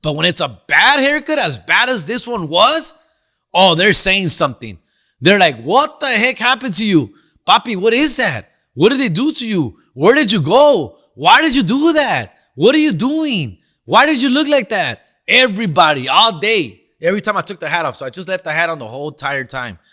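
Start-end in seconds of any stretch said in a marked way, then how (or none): 5.6-15.0 higher-pitched mock scolding, question after question; mock family outcry. Family grilling him over his bad haircut, in mock dismay. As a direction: imitate relatives demanding an explanation.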